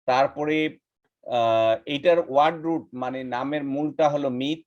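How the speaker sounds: normal talking voice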